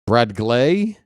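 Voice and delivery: "Brad Glay" weighted on GLAY, rising; stress on GLAY. Shown as a demonstration. Announcer following the stress instruction, doubtful punch on "Glay". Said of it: "put the emphasis on the second syllable".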